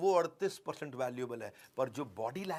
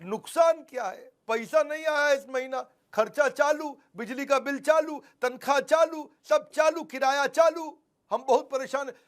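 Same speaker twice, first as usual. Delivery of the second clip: higher and louder, piling up complaints; agitated customer; 10 semitones higher, 8 dB louder than usual. Acted. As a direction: vent like a desperate business owner.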